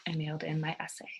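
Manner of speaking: ordinary delivery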